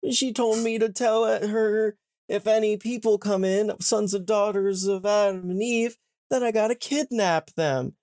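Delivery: hammed-up cheerful back-and-forth; chipper mock dialogue. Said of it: "she's like".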